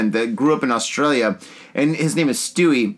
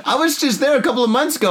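ordinary delivery

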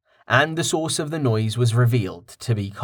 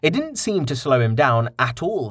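plain reading voice

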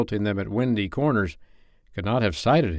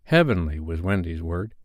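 plain reading voice

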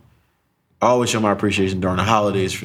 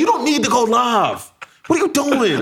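irritated; 11 semitones higher than usual. Retold or imitated. Son telling mom she doesn't need to go live, irritated. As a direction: scold her like the parent. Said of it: "I was so irritated".

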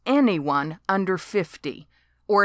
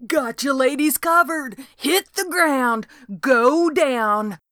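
yelling; 7 semitones higher, 4 dB louder than usual. A narrator's reading. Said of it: "Devin kept yelling".

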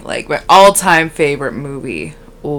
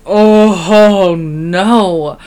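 drawn-out, loud mock dismay; awful acting; 2 semitones higher, 8 dB louder, much slower than usual. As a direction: ham it up like a terrible actress. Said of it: "that awful acting".

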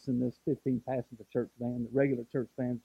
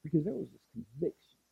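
ordinary talk

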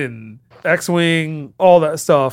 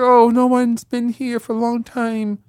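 put-on woman's voice; 5 semitones higher than usual. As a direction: draw it out high like a woman stumbling on a visitor. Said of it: "Why you gotta do a voice like that?".

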